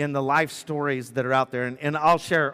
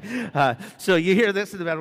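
normal talking voice

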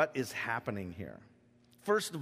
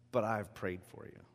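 tender; 6 semitones lower, 7 dB quieter than usual. Acted. Jesus's tender words repeated.

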